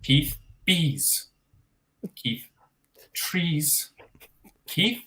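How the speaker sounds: slow, hushed, pausing between words; poem recital. Acted. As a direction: read each word as a hushed dramatic verse. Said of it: "I call this one Keith".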